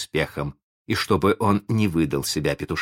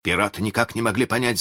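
plain reading voice

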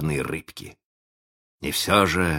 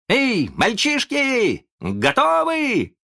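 put-on high, drawn-out boyish hail; cheerful call; much slower than usual. A narrator's reading. Boy rallies his friends, cheerful. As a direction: call out merrily, pitched way up. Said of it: "раздался весёлый голос Питера".

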